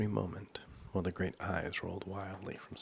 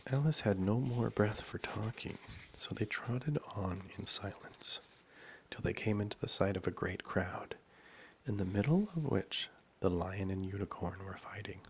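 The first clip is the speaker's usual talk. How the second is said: ordinary delivery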